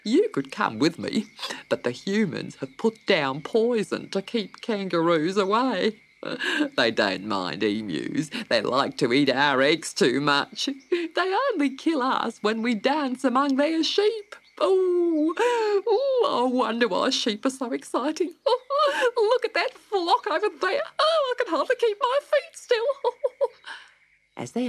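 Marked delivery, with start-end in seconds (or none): 0.0-22.9 rising to much higher, giggly and excited; a bird who can't keep still; giddy emu. A narrator's reading. Emu spots the sheep, high and giggly. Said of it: "said the big bird". 22.9-23.8 higher giggling bird laugh; a silly excited bird; emu laugh. A narrator's reading. Emu giggles to Dot, high. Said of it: "the big bird".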